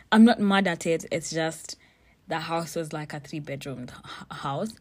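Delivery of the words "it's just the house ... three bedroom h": normal talking voice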